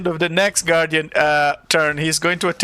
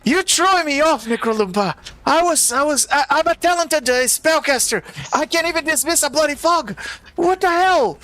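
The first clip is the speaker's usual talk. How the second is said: frustrated wizard; 9 semitones higher than usual. Acted. GM voices frustrated NPC spellcaster.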